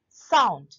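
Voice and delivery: "Sound" hit loud with a falling tune; stressed syllable. Shown as a demonstration. Teacher showing the stressed word, "sound" said loudly. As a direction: punch "sound" as the strong beat. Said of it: "ये stressed हुई".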